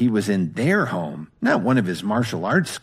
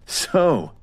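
high, sneering contempt; disdainful; 7 semitones higher, 3 dB louder, slower than usual. Acted. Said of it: "Meriwether said with disdain".

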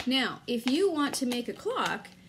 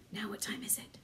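quick gasp, then a whisper; excited whisper; 2 semitones lower, 11 dB quieter, faster than usual. Acted. Teacher sneaks toward the wolf with the class, whispering. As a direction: hush it like a secret.

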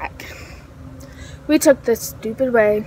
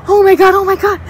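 high, louder re-enacted alarm; retold panic; 7 semitones higher, 5 dB louder, faster than usual. Friends spotting the ladies' car, panicked retold outcry. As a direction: imitate panicked friends, voice pitched up.

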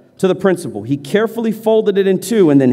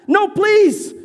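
begging; 13 semitones higher than usual. Acted. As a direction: beg with everything in you. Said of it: "the former rebel begged".

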